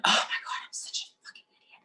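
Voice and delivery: whispered self-berating; a whispered scolding of yourself; harsh self-talk whisper. Retold or imitated. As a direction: hiss insults at yourself under your breath. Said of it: "We start talking to ourselves like this".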